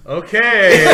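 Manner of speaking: mock awkward segue. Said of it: "Steven would try so hard to segue".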